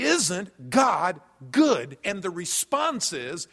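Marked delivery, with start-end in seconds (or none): 0.0-2.0 slow tender question. Retold or imitated. Pastor asks friend slowly.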